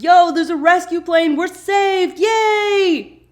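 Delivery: high, loud fake cheering; mock rescue cheer. Hurley fakes a rescue to test the patient, loud cheer. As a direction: yell it like rescue has come to wake him. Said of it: "And he's like".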